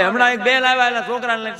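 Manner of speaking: usual speaking voice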